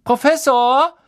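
high, loud schoolboy rasp; rasping tattletale Malfoy. A narrator's reading. Malfoy calls Snape to tell on Ron, high rasping call. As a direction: call out shrilly for the teacher. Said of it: "schnarrte Malfoy".

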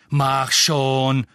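loud impatient snap. A narrator's reading. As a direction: jab at the lift impatiently. Impatient at the lift.

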